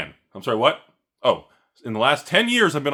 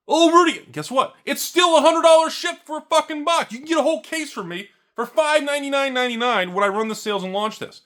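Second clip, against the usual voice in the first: mock taunting buyer; 14 semitones higher, 6 dB louder than usual.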